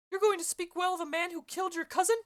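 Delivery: high-pitched nurse voice, indignant question; the Nurse scolding Juliet, high and sharp. Acted. Nurse's lines in read play.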